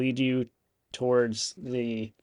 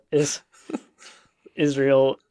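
usual speaking voice

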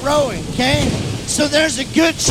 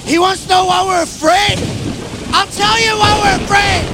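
mock exasperated rant; 6 semitones higher than usual. Disciples' exasperated rant, voiced.

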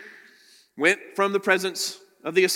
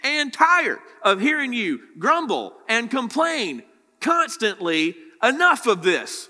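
loud, higher exasperated rant; fed up; 8 semitones higher, 6 dB louder than usual. Retold or imitated. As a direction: vent like a leader at the end of his rope. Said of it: "He's basically saying".

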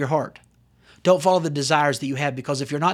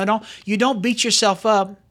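ordinary talk, nothing marked

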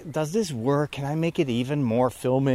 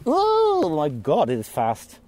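sudden startled exclamation; 2 semitones higher, slower than usual. Real, not acted. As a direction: caught off guard by something fast. Caterpillar suddenly climbing fast.

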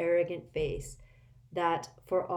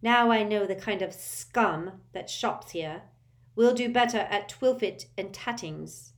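contemptuous; 4 semitones higher, 5 dB louder than usual. A narrator's reading. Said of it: "with a contemptuous glance".

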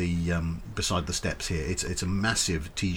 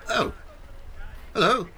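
much higher, bright startled greeting; a little rat caught off guard; startled rat clerk. Acted. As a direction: rat clerk bumping nose to nose. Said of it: "a rat who isn't really looking".

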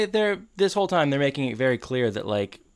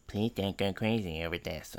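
mumbled, very soft mocking echo; imitating Corey; 3 semitones lower, 9 dB quieter than usual. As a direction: parrot the plea back under the breath. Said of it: "And Corey goes".

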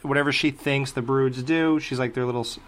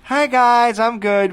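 higher, bright reassuring put-on voice; mock chirpy actress; 9 semitones higher, 4 dB louder, slower than usual. Host imagines the bloodied actress reassuring child actors, mock chirpy. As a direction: pretend to be the actress calming the kids, pitched up. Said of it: "they must have said".

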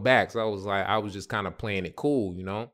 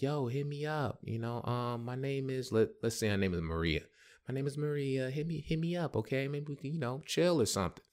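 higher, softer voice of a woman; quietly coaxing, pitched up; flirty woman's invitation; 5 semitones higher, 5 dB quieter than usual. Retold or imitated. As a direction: lightly voice her, soft and lifted. Retold woman giving her number.

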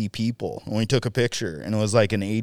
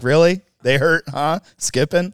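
sarcastic disbelief; 5 semitones higher, 5 dB louder than usual. Retold or imitated. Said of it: "It's like".

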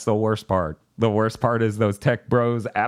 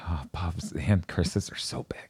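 slow, breathy whispered aside; whispering; 8 semitones lower, 8 dB quieter than usual. Real, not acted. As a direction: whisper it under your breath. Aside about Bob on camera.

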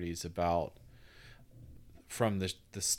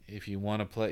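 ordinary talk

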